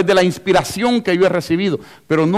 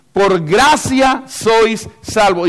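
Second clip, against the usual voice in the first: slow, raised proclamation; clear, emphatic quoting; 4 semitones higher, 4 dB louder, much slower than usual. Acted. Preacher repeating Ephesians to drive it home, emphatic. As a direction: ring out each word, higher and drawn out. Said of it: "dice clarito".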